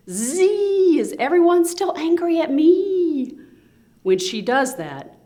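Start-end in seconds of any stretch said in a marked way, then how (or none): 0.1-3.4 very high, thin buzzing whine; whining mosquito. Acted. Mosquito whining in people's ears, high buzz. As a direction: buzz it in someone's ear like a mosquito. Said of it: "she goes about whining".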